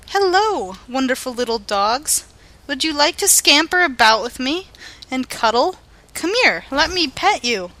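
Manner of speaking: high, sing-song sweet greeting; pleasant-like. Pretend. Nexus invites dogs to cuddle, pleasant and high. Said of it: "all pleasant-like".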